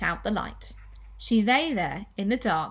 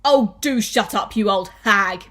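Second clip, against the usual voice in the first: loud, cross husband; irritated outburst; 9 dB louder than usual. Acted. Mister Twit silencing his nagging wife, irritated. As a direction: cut her off with a loud, annoyed rebuke.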